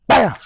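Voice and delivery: short, punchy "bam"; punch sound. Retold or imitated. Coach retelling shots on a heavyweight, punch sound. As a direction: fire off a bam like a landed shot. Imitating punches in his story.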